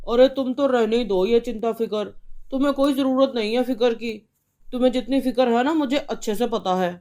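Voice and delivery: a husband furious about the fine; angry father. Acted. Father scorns wife.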